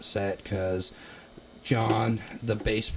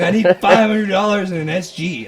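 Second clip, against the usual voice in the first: frantic; 11 semitones higher, 13 dB louder than usual. Retold or imitated. Urgent request to parents.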